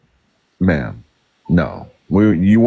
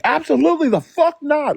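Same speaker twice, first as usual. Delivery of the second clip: retold outraged refusal. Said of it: "And Bold was like".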